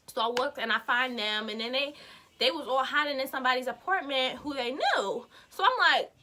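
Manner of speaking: usual speaking voice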